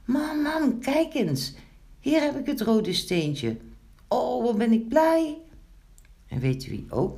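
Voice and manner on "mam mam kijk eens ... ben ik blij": put-on high, delighted child's shout; joyful calling. A narrator's reading. Girl shows mother the red stone, joyful calling. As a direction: call out, bursting with joy. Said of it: "hoorde Pinkeltje opeens roepen".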